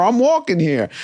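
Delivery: high, nasal-free New York street quote; Ratso Rizzo New York accent. Retold or imitated. Quoting Midnight Cowboy to his costar, New York accent. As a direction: quote Dustin's line like Ratso. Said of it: "a famous scene where Dustin says".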